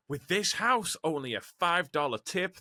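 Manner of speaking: put-on American accent. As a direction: say it like an American delivery driver. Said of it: "That's my American accent".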